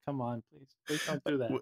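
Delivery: soft, deflated mock plea; hushed, unimpressed appeal; low, weary plea. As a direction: drop it low and quiet, pleading. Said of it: "now we're just like".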